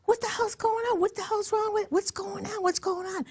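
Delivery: high, bewildered questions; confused alarm. Retold or imitated. Asking the table what happened, high and bewildered. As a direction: act baffled at the sudden commotion. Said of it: "and I go".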